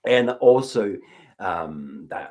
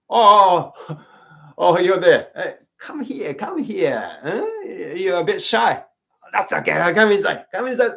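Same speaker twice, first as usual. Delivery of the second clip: loud, high call across a distance; calling to someone far off; 7 semitones higher, 5 dB louder than usual. Shown as a demonstration. Host calling out to a shy visitor far away. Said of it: "someone standing outside far away".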